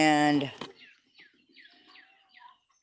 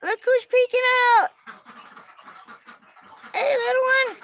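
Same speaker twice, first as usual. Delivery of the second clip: higher, sweet cooing voice; 9 semitones higher than usual. Real, not acted. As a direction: coo at a newborn kitten. Cooing at newborn kittens.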